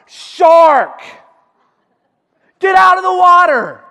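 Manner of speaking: high, loud alarm shout; shouted warning. Shown as a demonstration. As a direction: yell it like the shark is right there. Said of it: "What should you say when you see a shark?".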